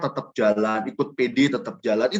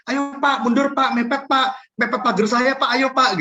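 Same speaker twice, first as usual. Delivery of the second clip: much higher, brisk parking-attendant call; calling out directions; 9 semitones higher than usual. Retold or imitated. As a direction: wave the neighbour back like a parking guide, brightly. Directing neighbour's car parking. (memanggil seperti tukang parkir dengan suara tinggi)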